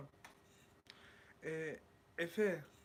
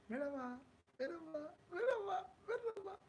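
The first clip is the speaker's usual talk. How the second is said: each merhaba higher than the last, ending very high; rising playful greetings; 13 semitones higher than usual. Pretend. Streamer greets a new viewer, each hello climbing higher. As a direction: climb the pitch up step by step.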